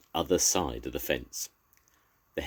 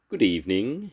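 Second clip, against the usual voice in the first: polite; 6 semitones higher, 4 dB louder than usual. A narrator's reading. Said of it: "he said politely".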